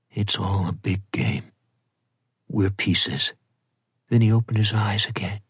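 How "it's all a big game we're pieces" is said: low, restrained, stiffly held delivery. A narrator's reading. Bride answers Hennessy about the game, stiff voice. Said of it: "In a stiff voice".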